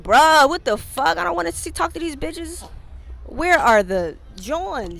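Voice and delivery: exasperated, loud and high; frustrated outburst. Retold or imitated. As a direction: vent like you're fed up. Retold frustration at speed dating.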